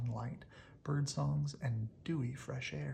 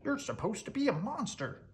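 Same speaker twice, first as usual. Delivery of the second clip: scolding little creature; 9 semitones higher than usual. Acted. The little terrors scold Monster.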